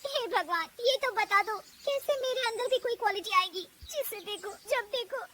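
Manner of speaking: high, plaintive plea to God; sorry-for-herself bahu, near crying; tearful lament. Acted. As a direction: wail the complaint, heading into tears. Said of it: "वो रोने लगती है".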